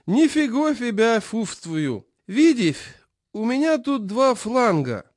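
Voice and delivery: put-on high, slow lisping old man; toothless old man. A narrator's reading. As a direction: mumble slowly through gums. Chairman voiced, toothless lisp.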